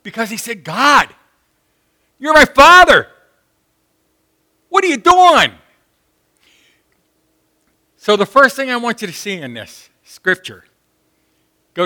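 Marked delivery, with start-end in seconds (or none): 0.6-5.5 raised, higher pleading cry; anguished cry to God. Retold or imitated. Preacher voicing Jesus's cry to God, anguished and raised. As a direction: cry out to a Father who's silent.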